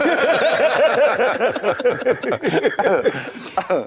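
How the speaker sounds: high, long helpless laugh; real laughter. Real, not acted. Footballer cracking up retelling Clough story, long laugh. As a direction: burst out laughing at the memory.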